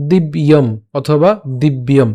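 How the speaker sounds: দিব্যম said slowly and carefully twice; slow demonstration. Shown as a demonstration. Teacher showing how দিব্যম sounds, slow and deliberate. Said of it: "উচ্চারণটা কেমন হচ্ছে?".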